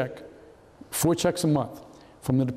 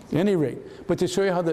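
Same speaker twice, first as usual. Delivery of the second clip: ordinary talk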